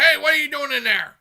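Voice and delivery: mock holler, high and loud; foreman calling out. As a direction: imitate a foreman hollering at a trespasser.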